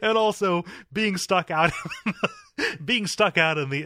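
high-pitched, helpless laughing protest; talking through real laughter. Real, not acted. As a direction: argue back while cracking up. Cracking up at co-host's joke.

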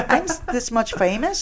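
high, slow teasing question; mock small voice. Joking about fame to a relative, high slow tease.

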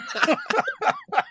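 shrieking burst of laughter; big high laugh. Real, not acted. Laughing at own joke.

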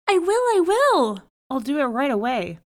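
high, loud burst, then easing down; eager relief. A narrator's reading. Man allowed to clear his hut, overjoyed and eager. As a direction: agree at once, bursting with eagerness.

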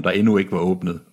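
ordinary delivery, nothing marked

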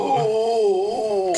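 put-on high, quavering old man groan. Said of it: "It's a very old man".

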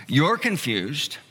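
normal talking voice

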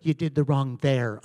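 wife correcting text. Retold or imitated. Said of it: "she's like".

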